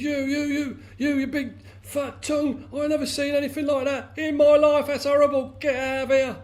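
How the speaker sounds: very high, loud panicked frog; scared well frog. A narrator's reading. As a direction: shriek in horror as a frightened frog. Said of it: "is really scared, shivering".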